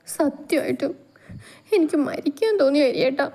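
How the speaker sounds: high, trembling voice choking back tears; holding back sobs. A narrator's reading. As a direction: whimper it while swallowing sobs. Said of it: "വിതുമ്പലടക്കി അവൾ പറയെ". (വിതുമ്പലടക്കി പറയുന്ന ഗൗരി)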